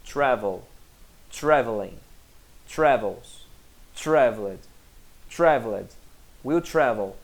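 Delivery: "Travel, traveling, travels" each low, firm and falling, with pauses; same intonation throughout. Shown as a demonstration. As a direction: recite every form of "Travel" on an identical low falling tune. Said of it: "a entonação do verbo travel é sempre a mesma".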